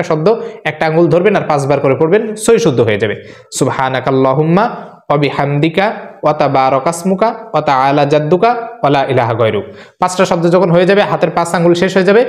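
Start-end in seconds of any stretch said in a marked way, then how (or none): 3.6-9.6 careful recitation. Shown as a demonstration. Teacher recites prayer again.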